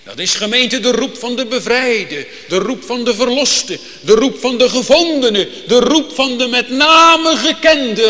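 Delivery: steeds luider en hoger, opbouwend; vurig preken. Acted. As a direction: bouw op tot een jubelende climax. Preacher climbs in full force. (steeds luider en hoger jubelend gepreekt)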